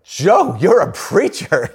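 high, loud, drawn-out imitation of amazed coworkers; mock exclamation. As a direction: call out like a stunned crowd of buddies. Retold coworkers exclaiming at Joe.

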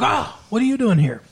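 high surprised question; startled, pitch jumping up. Retold or imitated. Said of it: "she's like".

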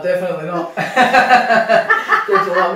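loud, laughing no; laughing refusal. Real, not acted. Boxer laughing off the interviewer's pedicure question. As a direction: laugh it off as a joke.

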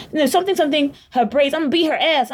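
high-pitched, half-remembered imitation of a rant; mock ranting. Friend retelling a stranger's threat to her, high mock rant. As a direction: mimic a ranter, filling in forgotten words.

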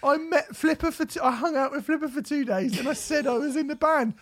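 very high squeaky mock voice. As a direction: brag in a silly tiny voice. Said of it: "mocking [name]'s claims".

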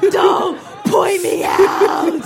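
slow, high, loud protest; mock outraged shout. Imitating a friend singled out in the audience, high drawn-out shout. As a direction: yell each word as the embarrassed friend.

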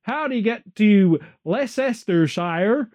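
put-on slow, drawn-out American accent asking directions; American impression. Brit doing his best American tourist, in a slow American accent. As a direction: play a lost American tourist in a broad American accent, slowly. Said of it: "best American impression".

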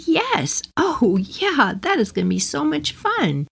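high, eager yes; thrilled to accept a treat; delighted reply. Retold or imitated. Replying gladly to son's surprise outing.

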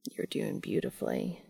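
very low, whispered praise; gentle. A narrator's reading. Cass encouraging Dean, gently and hushed. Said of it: "Cass offers gently".

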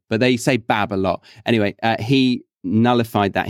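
usual speaking voice

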